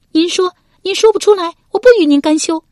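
loud, very high female voice; high-pitched angry ultimatum; defiant challenge. Acted. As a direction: dare him in a high, loud lady's voice. Wife defiantly dares her husband.

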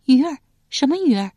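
put-on puzzled girl asking what he means; 满脸困惑地问. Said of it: "燕儿满面困惑道".

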